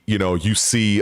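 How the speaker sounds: ordinary talk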